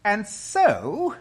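high, loud, resuming her claim; witch's imperious voice. A narrator's reading. The witch continues to Aslan, imperious. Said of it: "continued the witch".

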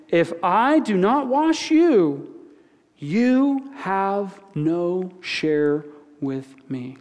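very slow, drawn-out words of Jesus; a solemn, unhurried scripture reading. Acted. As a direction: let each word land slowly and gravely.

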